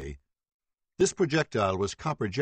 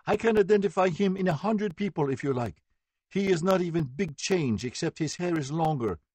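high, louder, insistent voice; agitated certainty; 8 semitones higher, 3 dB louder than usual. A narrator's reading. Victim telling the court she knows him, high and agitated. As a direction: certain and shaken.

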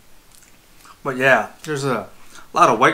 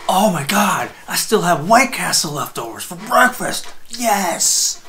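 excited; 6 semitones higher than usual. Retold or imitated.

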